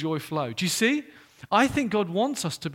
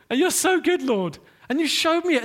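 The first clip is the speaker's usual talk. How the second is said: silly self-mocking voice; 9 semitones higher, 6 dB louder than usual. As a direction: ham it up like a fool owning his mistake. Repeating confession in put-on voice.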